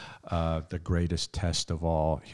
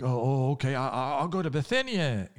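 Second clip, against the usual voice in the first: higher, stammering retreat as Paul; a startled Paul giving in quickly; flustered Paul; 6 semitones higher than usual. Retold or imitated. Paul backs down to God.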